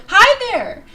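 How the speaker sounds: high, loud, stretched hi; excited hi. Real, not acted. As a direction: burst out with a big hello. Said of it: "I have energy and I have to say things".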